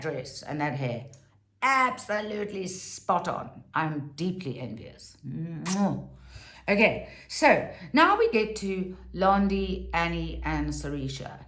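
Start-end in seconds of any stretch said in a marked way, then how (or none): 5.3-6.1 air kiss. Real, not acted. Blowing a kiss.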